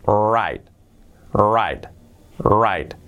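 WRITE as "rait", silent w, falling; correct pronunciation. Shown as a demonstration. Teacher models how write sounds, correct pronunciation. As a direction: repeat "write" slowly apart, sounding like "right". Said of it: "la pronunciación es".

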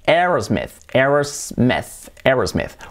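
"Aerosmith" as ERS-meth, second one drawn out; English-style AIRS-meth; English pronunciation. Shown as a demonstration. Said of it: "se pronuncia como una E".

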